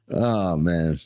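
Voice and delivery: usual speaking voice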